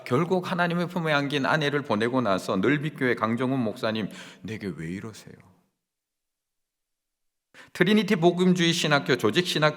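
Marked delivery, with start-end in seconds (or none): 4.4-5.3 quiet grieving question. Retold or imitated. Pastor's grieving question to God.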